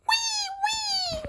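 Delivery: high-pitched toy weeping; pretend crying. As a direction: squeal a make-believe little "wee" cry. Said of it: "She go".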